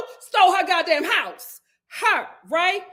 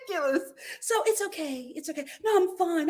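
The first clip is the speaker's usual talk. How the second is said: usual speaking voice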